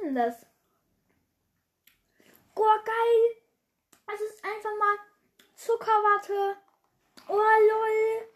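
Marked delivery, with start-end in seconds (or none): 2.5-8.3 high, delighted cries over the taste; thrilled by the cotton candy flavour; bright happy yelps; excited squeal. Real, not acted. Tasting a candy she loves.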